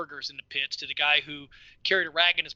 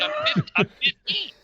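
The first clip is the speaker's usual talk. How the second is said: high, stammered mock answer; flustered kid; 9 semitones higher, 4 dB louder than usual. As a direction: imitate a kid put on the spot. Retold boy answering the official.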